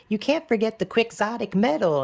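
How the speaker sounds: high, put-on teen girl. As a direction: chirp it like a know-it-all girl. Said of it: "she's like".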